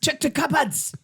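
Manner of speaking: high fake German order; hammy German officer command; German accent. Mock German raid voices.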